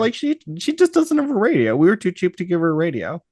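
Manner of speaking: lightly voiced breezy owner, high and fast; mock ship owner voice. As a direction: shrug it off like a careless boss. Mocking the ship's owners.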